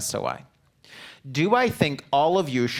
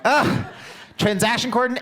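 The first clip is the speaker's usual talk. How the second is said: high laughing burst, louder; giggling while recalling the term; 10 semitones higher, 4 dB louder than usual. Real, not acted. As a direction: laugh through the word as it slips away. Laughing at forgetting the word.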